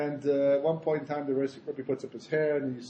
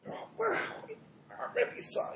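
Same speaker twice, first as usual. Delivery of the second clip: high, hushed mock wonder; airy whispering of starstruck disciples, imitated; awestruck; 9 dB quieter than usual. Chassidim learning whom their Rebbe saw, hushed awe.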